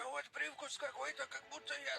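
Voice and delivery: shocked. Retold or imitated. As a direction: blurt it out in disbelief. Retells own worried reply.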